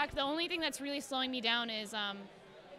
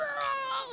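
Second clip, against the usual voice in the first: high imitated roar; high, drawn-out mock yowl; cat's roar; 13 semitones higher than usual. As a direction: roar like a raging cat. Imitating cat shaking the door.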